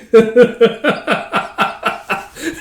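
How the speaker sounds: high, long cackling laugh; real laughter. Real, not acted. Reader amused by the scene, laughing. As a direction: crack up helplessly.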